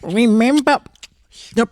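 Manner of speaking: mock concern through food; mouth full. A narrator's reading. Husband reminds wife with mouth full, high. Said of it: "his mouth was so full that he could hardly speak".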